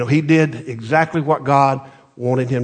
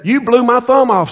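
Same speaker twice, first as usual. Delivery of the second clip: 1 king's angry outburst; 9 semitones higher, 5 dB louder than usual. Retold or imitated. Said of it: "So he said".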